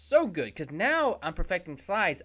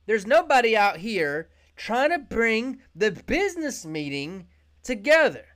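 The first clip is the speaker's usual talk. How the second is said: Zoom's pitch; 6 semitones higher, 5 dB louder than usual. Acted. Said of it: "Zoom jump on this mountain and say".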